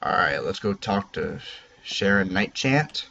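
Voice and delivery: usual speaking voice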